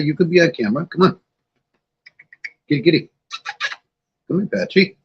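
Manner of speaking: high coaxing pet-talk; coaxing the cat. Real, not acted. Streamer coaxing his cat toward the camera, high pet-talk. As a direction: call the cat sweetly, up high.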